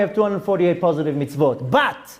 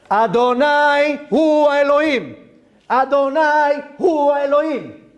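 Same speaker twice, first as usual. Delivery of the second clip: proclaimed cry; 4 semitones higher, slower than usual. Acted. Rabbi voicing the final cry at Mashiach's coming, slow ringing call. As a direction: call it out like the whole people crying to God. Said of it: "What do we scream?".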